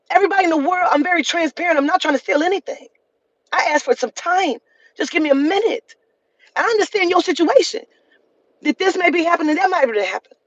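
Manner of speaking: heated rant. Real, not acted. Venting anger at employee.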